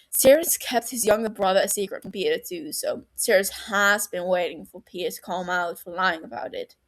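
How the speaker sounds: ordinary delivery, nothing marked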